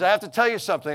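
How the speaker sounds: high, loud mock blurt. Retelling the lady's urgent news to him, high loud blurt. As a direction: imitate an over-eager outburst. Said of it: "she blurts out".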